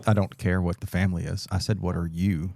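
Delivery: normal talking voice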